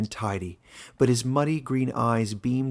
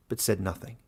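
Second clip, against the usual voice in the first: ordinary delivery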